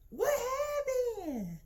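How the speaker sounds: imitating mom's country twang; mom's country accent. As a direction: let the country accent slip out as mom. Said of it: "that country slip out".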